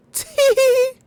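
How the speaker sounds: squeaky high tee-hee giggle; loud squeaky tee-hee. Pretend. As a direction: giggle like a cartoon imp. Giggly cartoon voice again.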